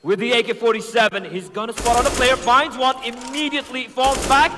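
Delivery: shouting hype caster call; esports caster. Acted. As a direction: build to a roaring clutch call. Ace clutch play-by-play.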